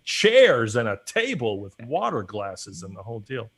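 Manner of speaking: excited, emphatic starstruck retelling; gushing over every detail; mock awe. Guest retells being impressed by the podcasters' gear, awestruck.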